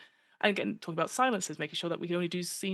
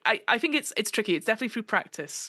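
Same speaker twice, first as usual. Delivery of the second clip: usual speaking voice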